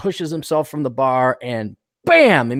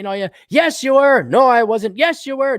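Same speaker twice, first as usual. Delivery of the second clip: high, louder back-and-forth bickering; mock quarrel; 10 semitones higher, 5 dB louder than usual. Husband and wife bickering in the joke, high and loud. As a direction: bicker like a couple.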